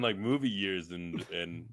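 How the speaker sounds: ordinary talk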